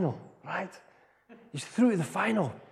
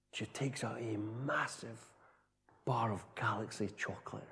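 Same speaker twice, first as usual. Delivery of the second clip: suspense whisper; 8 semitones lower, 14 dB quieter than usual. A narrator's reading. Suspenseful reveal of the prize.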